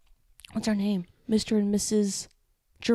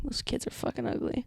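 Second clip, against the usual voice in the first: whisper; 3 semitones higher, 9 dB quieter than usual. Retold or imitated. Whispering to classmate during class.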